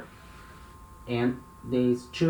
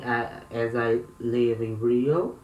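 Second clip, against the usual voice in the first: usual speaking voice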